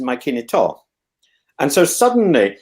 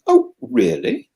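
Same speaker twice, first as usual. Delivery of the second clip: mock surprise; 8 semitones higher, slower than usual. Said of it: "you say".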